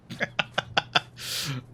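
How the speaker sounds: breathy, unvoiced burst of laughter; laughing. Real, not acted. Player cracking up at their parapet hearing joke, laughing. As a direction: laugh in a quick stuttering run.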